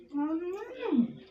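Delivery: long, high "mmm" of tasting; drawn-out tasting mmm; savouring hum. Real, not acted. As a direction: hum with relish, rising then falling. Tasting the finished sweet.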